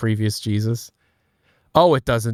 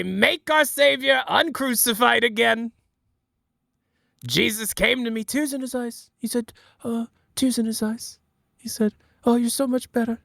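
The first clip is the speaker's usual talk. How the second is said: Trump preaching he is better than Jesus; Trump impression; 11 semitones higher than usual. Easter dinner bit for the Republican family, high Trump voice. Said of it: "where Trump does a speech".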